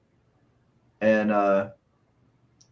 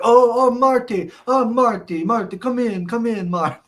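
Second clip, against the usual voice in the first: hammed-up Iron Sheik calling; mock Iron Sheik; 9 semitones higher, 5 dB louder than usual. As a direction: coax Marty in with a put-on voice. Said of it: "And he's like".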